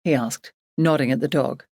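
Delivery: ordinary delivery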